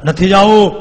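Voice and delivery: loud, high yell of refusal; defiant shout. Acted. Demon yelling as villagers drag him, loud shout. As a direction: shout it back at them. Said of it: "demon resists being dragged".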